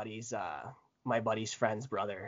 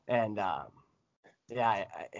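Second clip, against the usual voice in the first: usual speaking voice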